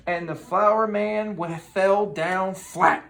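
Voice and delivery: slow, loud fell down flat; storybook climax; dramatic slow fall. A narrator's reading. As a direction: drag it out for the crash. Climactic crash.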